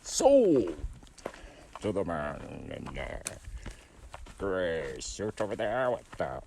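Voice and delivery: mock auctioneer call, sold shouted. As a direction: rattle off bids, bang the gavel on sold. Going once going twice sold.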